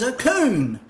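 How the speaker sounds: put-on Northern accent, say coon with an oo vowel; Northern accent cone. Worker pointing at a cone, insistent Northern accent oo. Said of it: "and he says".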